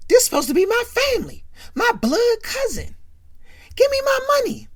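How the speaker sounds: furious yell. Retold or imitated. Marcus yelling at his cousin over money, furious. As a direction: scream at him for the money.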